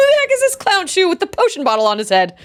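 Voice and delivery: fast, high-pitched incredulous question; baffled outrage. Acted. Ridiculing the one in a potion hat, incredulous.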